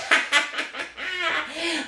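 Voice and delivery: mischievous character laugh; witch cackle. Acted. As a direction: cackle in a rapid run of ha-ha-ha.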